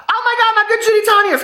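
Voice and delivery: sudden very high, fast burst of surprise; startled squeal. Real, not acted. Seeing Tanya calling from Mexico, startled squeal. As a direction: shriek in surprise at the phone.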